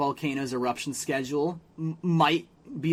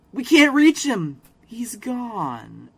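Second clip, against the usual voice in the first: mock Jimmy; 6 semitones higher, 4 dB louder, much slower than usual. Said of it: "Jimmy's like".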